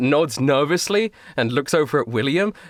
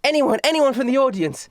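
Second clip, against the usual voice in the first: high-pitched call to the audience; searching the crowd, theatrical and high; eager magician seeking a volunteer; stage magician showman; 11 semitones higher than usual. Acted. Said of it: "she looks around and she goes".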